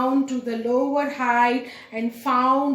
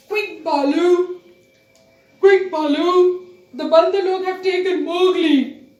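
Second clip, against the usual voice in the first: urgent call; 4 semitones higher, 6 dB louder than usual. Acted. Bagheera urgently calls Bhalu.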